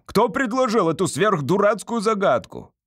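high, indignant outburst; indignant yell. A narrator's reading. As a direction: yell it as an outraged judge. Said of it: "завопил председатель суда".

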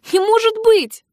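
high, loud exclamation of disbelief; aghast, pitch leaping way up; shocked disbelief. Acted. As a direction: cry out as the truth hits. Girl shocked at discovering who he is.

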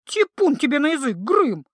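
outraged at the troll's words; indignant. A narrator's reading. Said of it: "возмутилась знахарка". (возмущённо, громко)

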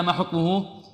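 normal talking voice

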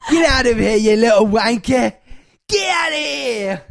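Carol. Pretend. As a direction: shoo them off like an angry harridan. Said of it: "She went real dark".

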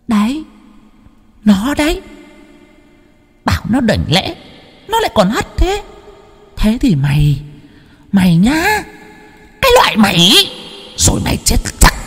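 high shrieking spirit threat; glaring ghost. A narrator's reading. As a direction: spit the threat like a vengeful ghost. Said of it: "cô ấy quắc mắt". (vong quắc mắt đe dọa the thé)

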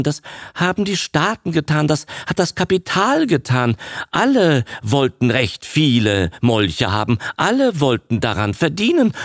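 a son talking fast to shake his father off his guilt; rough insistence. A narrator's reading. Son rushes to argue his father out of self-blame, insistent. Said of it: "sagte der Sohn rauh".

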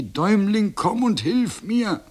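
high, drawn-out call for help; plaintive high-pitched cry. A narrator's reading. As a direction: call out high and slow, like a gander in trouble. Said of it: "Der Gänserich schrie".

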